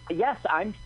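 usual speaking voice

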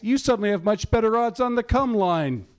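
ordinary talk, nothing marked

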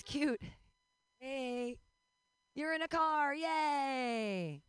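playful call-out with a long yay; mock cheering. Comic hailing someone in a car, mock cheering.

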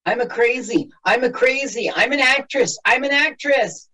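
high-pitched hammy crazy lady; high mock crazy voice. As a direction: squeal it like a ham actress. Mocking a movie character.

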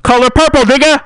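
high loud yelled punchline; shout. Acted. Comedian yelling a punchline, loud. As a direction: blurt it out loud.

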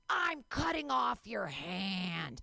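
very slow, drawn-out mock threat; menacing child torturer. Twins aiming a laser pointer at tied-up nanny, slow mock menace. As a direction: stretch each word like a playacting villain.

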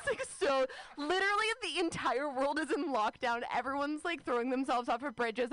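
ordinary talk

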